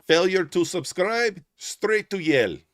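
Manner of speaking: high, loud, slow mock foreign accent, TikTok style. Streamer imitating the TikTok guy's odd accent, high and loud. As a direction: belt it like the TikTok accent guy. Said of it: "I don't know what that accent is".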